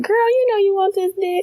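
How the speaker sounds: very high, squeaky come-on; high squeaky pickup line. Retold or imitated. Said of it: "Can you imagine though".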